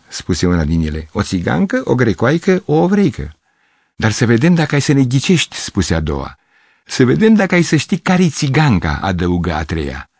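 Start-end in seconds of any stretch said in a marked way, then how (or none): 1.1-3.4 put-on high, playful girl's voice, rising toward the end; teasing young girl. Young girl teasing Gavrilescu, high and playful. 4.0-5.6 high, playful challenge; teasing girl. Acted. Second girl challenging Gavrilescu, high and teasing. 6.9-9.0 put-on high, teasing girl's voice; sly girl. Third girl daring Gavrilescu to guess, high and sly.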